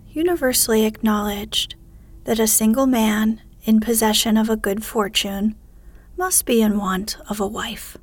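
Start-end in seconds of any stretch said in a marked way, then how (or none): none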